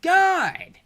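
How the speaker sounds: high, loud burst of frustration; exasperated cry. Real, not acted. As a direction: cry out, fed up with the opponent. Ends rant in exasperation.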